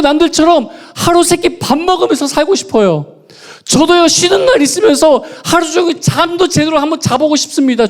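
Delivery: high, loud anguished cry to God; pouring out grievance. Retold or imitated. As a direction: shout the prayer like it's been held back for years. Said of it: "그 모든 억울함을 다 토해냈어요".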